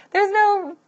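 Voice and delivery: high-pitched incredulous outburst, easing down; mock disbelief. Woman reenacting her reaction to horrible things, in high-pitched disbelief. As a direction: act out being unable to believe it.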